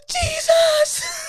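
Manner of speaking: high, loud mock shriek; kids' terrified scream. Kids seeing the Grither, terrified shriek. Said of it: "And they go".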